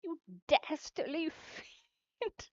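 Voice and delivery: high mock-British indignation, breaking into laughter; British insult. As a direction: fling it in a put-on British accent. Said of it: "my British insults".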